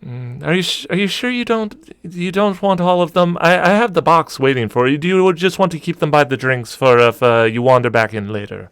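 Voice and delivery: barmaid voice. Acted. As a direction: gently offer the whole box to a regular.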